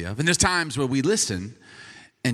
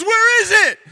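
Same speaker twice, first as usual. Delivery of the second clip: very high, loud mock scream; retold scream; 10 dB louder than usual. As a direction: imitate a wife shrieking in alarm. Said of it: "this scream".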